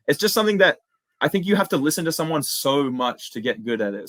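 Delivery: usual speaking voice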